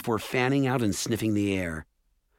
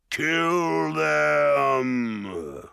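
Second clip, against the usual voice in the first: loud, higher ogre order; ogre's command; 5 semitones higher, 3 dB louder than usual. A narrator's reading. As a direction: bark the kill order.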